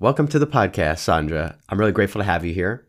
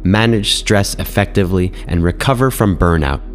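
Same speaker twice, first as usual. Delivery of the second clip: polished, evenly paced host read; measured podcast announcer delivery; guest bio read; 7 dB louder than usual. Acted. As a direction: present the guest from a prepared script. Reads scripted guest biography.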